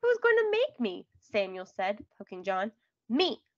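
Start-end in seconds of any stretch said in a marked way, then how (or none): 0.0-1.1 high, loud mocking dare; taunting challenge. A narrator's reading. Boy taunting rival before a fight. 3.1-3.4 short, raised defiant yell. A narrator's reading. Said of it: "John yelled".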